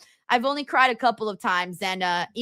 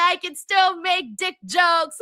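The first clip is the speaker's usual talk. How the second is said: celebratory cheer; 7 semitones higher than usual. Real, not acted. Streamer celebrating a renewed sponsorship to viewers, celebratory cheer. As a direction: cheer it out high and stretched. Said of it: "That's the real celebration right there".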